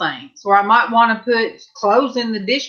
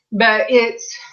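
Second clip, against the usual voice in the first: ordinary talk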